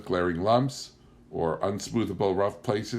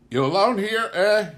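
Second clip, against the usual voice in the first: put-on high, loud leering drunken voice; 12 semitones higher, 7 dB louder than usual. Drunk stranger leers at the lone wife, menacing. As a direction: leer at her, menacing and drunk. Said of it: "leering formidably".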